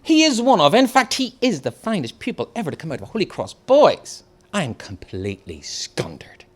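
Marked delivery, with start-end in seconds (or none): 0.0-4.2 higher, gushing praise; a hint of a beaming teacher; lightly voiced proud teacher. A narrator's reading. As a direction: show him off to the class. Teacher praising pupil to class.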